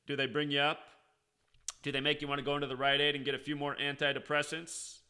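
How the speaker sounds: normal talking voice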